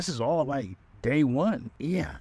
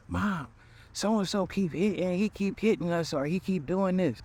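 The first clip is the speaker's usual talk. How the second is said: put-on much higher whiny child voice; kid tattling; 8 semitones higher than usual.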